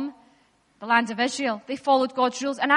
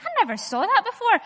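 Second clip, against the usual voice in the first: surprised realization; 8 semitones higher than usual. Retold or imitated. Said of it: "you're like".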